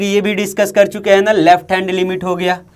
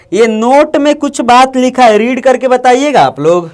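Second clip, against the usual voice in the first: calling out; 5 semitones higher, 4 dB louder than usual. Real, not acted. Teacher calls class to read.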